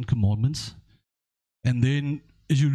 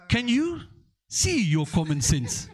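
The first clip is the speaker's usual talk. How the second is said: put-on high, slow, cheeky child question; little Nigerian boy; 6 semitones higher, slower than usual. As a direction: turn the professor's logic back on him. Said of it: "the little boy goes".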